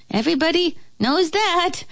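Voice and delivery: high, squeaky little creature; flea voice. A narrator's reading. Flea scoffing at the moth, squeaky voice. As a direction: pipe it up very high.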